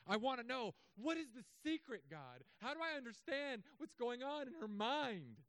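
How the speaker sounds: high-pitched mock desperation; exasperated plea. Husband asking God about his wife's mind, exasperated. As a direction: beg for the answer, pitch riding high.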